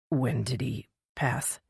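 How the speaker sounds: slow and softly pained, holding back grief; pained question. A narrator's reading. Hunter asks Finn about his mentor's death, slow and pained. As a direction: ask it like the words hurt to say. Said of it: "It hurt to say the words".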